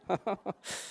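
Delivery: soft trailing chuckle; quiet real laugh dying away; short laugh. Real, not acted. Teacher amused by own accent mimicry, soft laugh.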